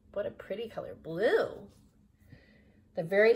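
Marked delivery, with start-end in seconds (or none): none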